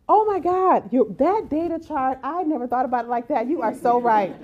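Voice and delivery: hammed-up sudden agreement; mock amazement. Opponent won over by the chart, gushing in mock amazement. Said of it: "And then they said".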